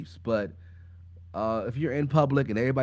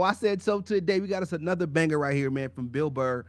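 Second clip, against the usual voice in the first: ordinary talk, nothing marked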